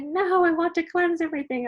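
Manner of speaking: stubborn client insisting. Retold or imitated. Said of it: "She's like".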